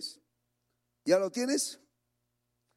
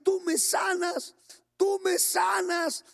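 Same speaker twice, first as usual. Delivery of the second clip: crying out to God; 12 semitones higher, slower than usual. Retold or imitated. Sick brother at the altar pleading to God, shouting. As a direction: beg the Lord to heal you right now. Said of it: "Así clamaba".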